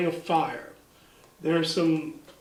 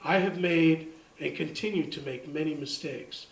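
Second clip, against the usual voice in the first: soft, lowered poetry reading; poem recital; 3 semitones lower, 5 dB quieter than usual. Acted. Poet reads Sequel for my wife, soft recital. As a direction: read it quietly, line by line.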